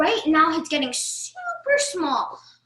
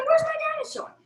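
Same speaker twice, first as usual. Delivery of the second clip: mock scream; 13 semitones higher, faster than usual. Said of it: "if you just scream".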